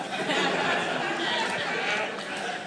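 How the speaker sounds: breathy, quiet trailing laugh. Real, not acted. Preacher enjoying his own punchline, soft laughter. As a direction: laugh softly at your own joke.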